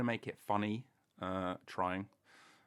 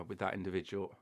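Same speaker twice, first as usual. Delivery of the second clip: normal talking voice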